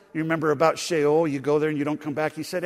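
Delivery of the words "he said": normal talking voice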